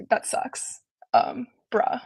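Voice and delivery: breathy whispered exasperation, no voice at all; whispered deadpan bruh. Retold or imitated. Showing the class an exasperated whispered bruh. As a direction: let it out like a tired sigh, whispered. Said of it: "sigh of exasperation".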